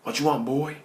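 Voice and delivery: gruff-mannered biker confronting a stranger; menacing biker. Acted. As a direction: demand it like a hostile bar regular. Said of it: "this voice out of nowhere says".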